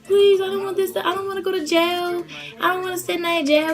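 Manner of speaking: high, whiny mock sobbing plea; imitated crying plea. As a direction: beg like a bawling girl, hammed-up. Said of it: "crying, like".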